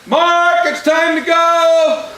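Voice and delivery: high, loud, drawn-out call; calling from afar. Acted. Parent calls the boy from three houses away, hollering. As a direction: sing it out down the street.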